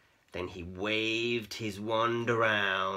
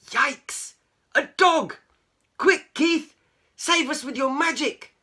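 high, loud cries for help; panicked squealing; 11 dB louder than usual. A narrator's reading. Cats spotting a dog, squealing in panic. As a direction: squeal it in alarm. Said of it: "the cat squealed in panic".

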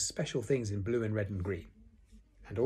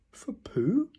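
high, breathy rising question; Eeyore taken aback; 13 semitones higher, 4 dB louder than usual. A narrator's reading. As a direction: ask in wounded disbelief. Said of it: "said Eeyore".

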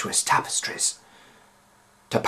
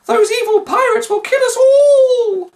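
high shrill wail of despair; 10 dB louder than usual. A narrator's reading. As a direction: wail it, on the edge of tears. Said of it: "wailed Annie Beth".

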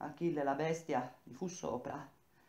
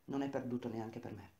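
ordinary delivery, nothing marked

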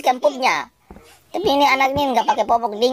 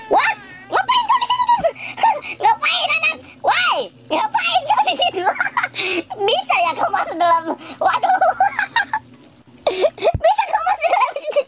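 very high squealing laughter through the words; laughing disbelief. Real, not acted. Gamer finds the baby in the washing machine, shrieking with laughter.

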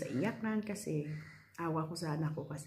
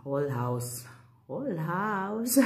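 whole house, half laughing groan; mock despair; 2 semitones higher, 5 dB louder, slower than usual. Real, not acted. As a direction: whine it in playful dismay. Mock dismay at big job.

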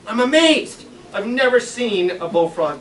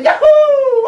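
very high, loud whoop; yahoo shout; 10 dB louder than usual. Retold or imitated. Boy sure he has caught the frog, gleeful whoop. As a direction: whoop with glee. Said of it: "I say".

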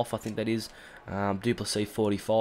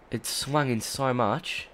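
normal talking voice

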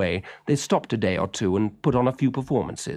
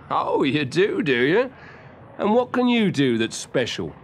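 lightly voiced man, amused and a bit louder; teasing circus man; 5 semitones higher, 3 dB louder than usual. A narrator's reading. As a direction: tease the boy, half amused.

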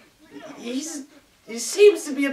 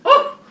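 high squeal then laugh; startled shriek; 14 semitones higher, 6 dB louder than usual. Acted. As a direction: yelp with surprise, then laugh.

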